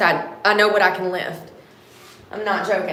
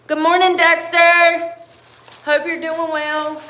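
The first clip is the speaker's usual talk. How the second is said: loud, high, drawn-out hello; cheery greeting; 7 semitones higher, 8 dB louder than usual. Real, not acted. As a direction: call out a bright morning greeting.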